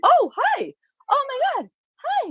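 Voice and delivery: delighted greeting. Real, not acted. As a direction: greet her like a surprise visitor. Greeting daughter who walked in.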